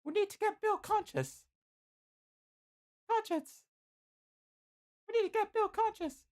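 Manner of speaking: very high falsetto woman's voice; urgent Licia. A narrator's reading. Licia wants the wounded hero roused, urgent falsetto. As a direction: press the order in high falsetto.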